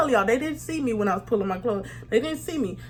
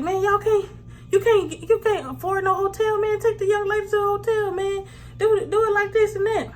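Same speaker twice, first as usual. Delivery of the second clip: higher-pitched mock cop voice; imitating the cop; 9 semitones higher than usual. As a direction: play the cop lecturing them, pitched way up. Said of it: "The cop was like".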